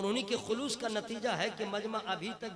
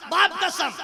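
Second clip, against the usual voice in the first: hammed-up shouted back and forth, higher and louder; mock argument; 10 semitones higher, 15 dB louder, slower than usual. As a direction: yell both sides of the quarrel. Retold argument, rising shouts. (नकली झगड़ा, ऊँची चीखती आवाज़ में)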